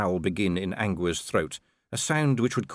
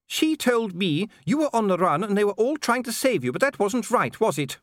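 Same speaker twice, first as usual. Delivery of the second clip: high-pitched, troubled wife; anxious Lady Sybil; 11 semitones higher than usual. A narrator's reading. Wife tells her husband what she was told, high-pitched. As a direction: question it, voice pitched well up.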